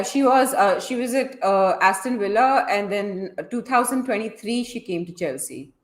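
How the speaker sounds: ordinary talk, nothing marked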